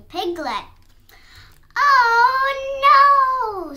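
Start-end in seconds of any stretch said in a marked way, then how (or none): 1.7-3.7 loud, high, drawn-out wail; storybook scream. A narrator's reading. Piglet facing the fox at the door, screamed. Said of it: "screamed the piglet".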